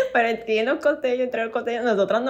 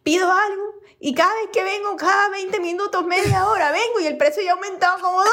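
exasperated customer; 10 semitones higher than usual. Retold or imitated. A regular in the queue complaining about rising prices, high and laughing. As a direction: whine like a regular fed up with the prices. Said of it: "un pana así".

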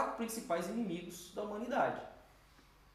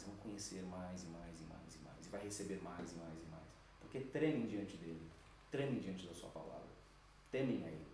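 hushed preaching; 11 semitones lower, 12 dB quieter than usual. Real, not acted. Quiet intimate preaching.

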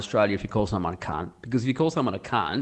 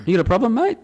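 high rising confrontational question; red-flag warning before a fight; pub challenge; 11 semitones higher, 4 dB louder, much faster than usual. Acted. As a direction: square up to someone in a bar. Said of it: "that's like the red flags up there".

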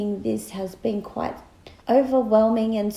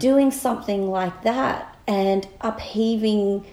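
normal talking voice

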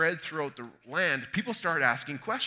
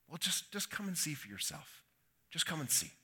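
simple reply; 2 semitones higher, 9 dB quieter than usual. Acted. Philip answering a skeptical Nathanael he can't convince, softly and simply. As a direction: say it simply and much more softly. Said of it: "So he simply replies".

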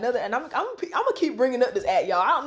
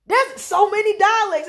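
high, slow, loud amazement; amazed; 9 semitones higher, 5 dB louder than usual. Retold or imitated. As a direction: marvel at it. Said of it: "you're like".

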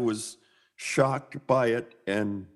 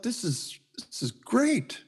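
awed delight; 8 semitones higher, 3 dB quieter, slower than usual. Retold or imitated. Retold delight at the airbrush.

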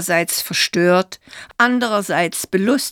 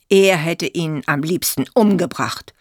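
ordinary delivery, nothing marked

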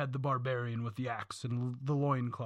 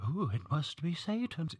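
mock spooky parent; 3 semitones higher than usual. Mocking panicked parents.

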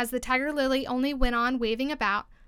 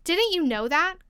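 slow, higher-pitched exclaimed question; incredulous question, pitched up; crying out; 6 semitones higher, 4 dB louder, slower than usual. A narrator's reading. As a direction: cry it out as a little daisy. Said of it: "cried another daisy".

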